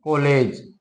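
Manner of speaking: कॉलेज with the open rounded ऑ of English college; example word. Shown as a demonstration. Teacher demonstrating the borrowed vowel, said precisely. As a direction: say कॉलेज carefully, not काॅलेज with a plain आ. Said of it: "जैसे हम कहते हैं".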